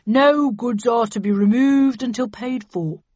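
slow, loud businesslike dealer; cold dealer. A narrator's reading. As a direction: lay down the rules firmly. Dealer stating terms coldly.

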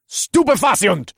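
high, loud incantation; shouted spell. A narrator's reading. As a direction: hurl the spell at full voice. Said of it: "I shouted".

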